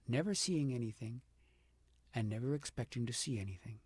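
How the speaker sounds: ordinary delivery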